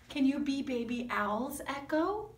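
ordinary talk

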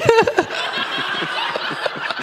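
airless, high wheezing laugh; breathless laugh. Real, not acted. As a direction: silent shaking laugh, no voice. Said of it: "we're just laughing".

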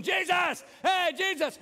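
loud, high shout for attention; calling out. Retold or imitated. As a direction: yell across the crowd to Jesus. Blind beggar calling out to Jesus.

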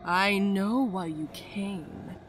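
slow, eerie voice from the dark; mysterious fortune teller. Acted. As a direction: draw it out like an old seer.

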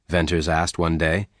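ordinary delivery

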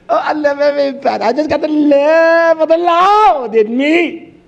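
high, loud, fast mock of a pious churchgoer; hammed-up caricature of a churchgoer; mocking sanctimonious voice. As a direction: sneer at their fake holiness with a sing-song voice. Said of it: "We've been taught, just say you love".

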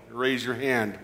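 normal talking voice

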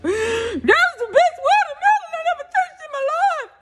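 very high, squealed monotone; hammed-up high-pitched rave; put-on high voice. Repeating her verdict on the watermelon, mock-overcome and high.

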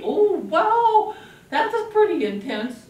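put-on high, loud oh wow for a child; mock surprise. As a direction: sound startled by the puppet's anger. Surprise at puppet's anger.

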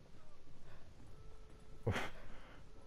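breathless high-pitched laugh; high wheezy laugh. Real, not acted. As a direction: laugh helplessly, airy. Laugh at failure.

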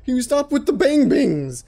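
high, stammering plea to stop the noises; frantic pleading. Real, not acted. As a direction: beg the game to stop, rattled and high.

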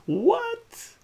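very high, loud outraged what; shocked squawk. Acted. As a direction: shriek in disbelief. Ataru shocked by alien planet demand.

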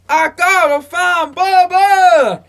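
a Forrest Gump drawl stretched slowly; Forrest Gump impression. Friend imitates Forrest Gump's line, slow and drawn out. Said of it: "that one scene in Forrest Gump".

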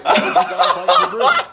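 laughing through the line. Real, not acted. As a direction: try to say it but break into laughter. Waiter breaks up laughing.

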